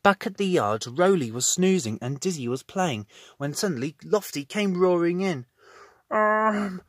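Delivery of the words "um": sleepy rumble. A narrator's reading. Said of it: "rumbled Roly sleepily".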